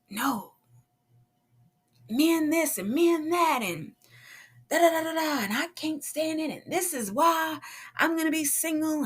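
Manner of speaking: mock exasperated venting. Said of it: "I'm venting and I'm cussing".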